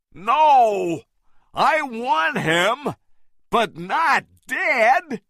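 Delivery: high, loud, slowed insistence; shouted. A narrator's reading. Carl stopping the men, a high insistent shout. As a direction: shout each word out firmly. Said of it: "Carl shouted".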